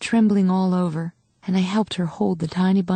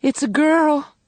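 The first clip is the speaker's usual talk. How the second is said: high, bright announcement; mother announcing the newborn; joyful; 8 semitones higher, 3 dB louder, slower than usual. A narrator's reading. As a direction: share the joy.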